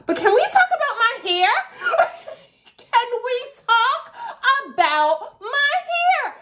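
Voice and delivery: can we please talk about this; mock-despairing question; exasperated. Real, not acted. Exasperated complaint about hair.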